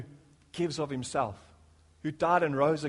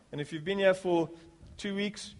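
ordinary talk

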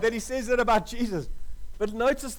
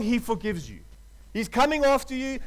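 ordinary talk

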